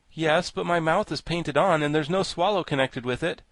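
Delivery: mildly animated storybook figure; lightly voiced Scarecrow, explaining. A narrator's reading. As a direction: explain the difficulty in character.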